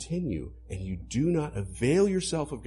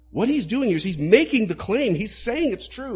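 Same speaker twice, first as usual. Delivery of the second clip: louder, higher, faster pulpit voice; full-force preaching; 9 semitones higher, 7 dB louder than usual. Acted. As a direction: drive the point home from the pulpit.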